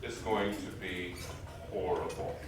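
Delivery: low, quiet and slow with mock dread; hushed, hammed-up foreboding. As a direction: mutter it like you know it'll go badly. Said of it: "Meriwether Lewis was like".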